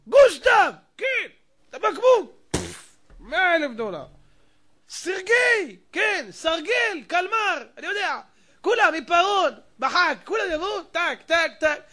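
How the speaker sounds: high, loud royal roll call; king calling roll. Acted. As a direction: bark out the names like a brisk king.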